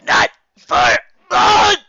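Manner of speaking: high, loud, slow groan-shout; loud cry. A narrator's reading. Dash to Applejack, loud slow cry. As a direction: yell from under the tackle.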